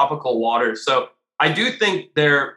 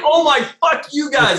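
outraged yell; 11 semitones higher, 4 dB louder than usual. Retold or imitated. Ditched next to feeding mako.

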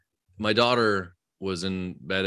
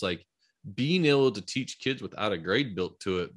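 normal talking voice

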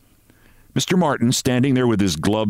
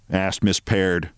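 ordinary delivery

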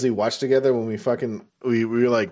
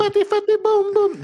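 very high, louder squeaky chant; squeaky singsong; 4 dB louder than usual. Retold or imitated. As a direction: chant it in a tiny cartoon voice. Quoting song title in voice.

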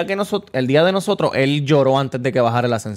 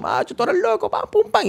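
much higher, giddy burst; wild excitement. Retold or imitated. Retelling the moment [name] appeared, wild excitement. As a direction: lose it like the fans did.

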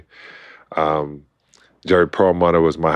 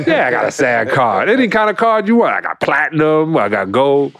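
higher-pitched, hammed-up bragging; boastful bluff; 7 semitones higher than usual. Bluffing the director about his sag card, boastful. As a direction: brag it up, pitch leaping on the big claim.

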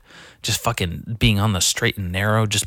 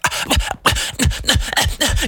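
high stuttering mock splutter; frustrated sputtering. Skate park people too frustrated to talk, sputtering. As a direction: imitate someone too worked up to form words. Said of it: "get so fucking uppity and frustrated".

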